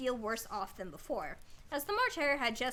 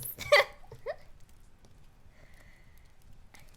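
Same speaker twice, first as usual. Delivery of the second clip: breathless laugh. Real, not acted. Laughing at herself.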